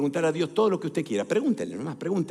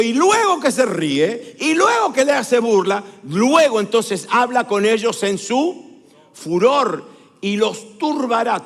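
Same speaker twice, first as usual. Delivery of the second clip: loud, slow, raised pulpit delivery; forceful declamation; 4 semitones higher, 5 dB louder, slower than usual. Real, not acted. Preacher proclaims God's fury on nations, forceful declamation. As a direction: declaim the verse with stretched key words.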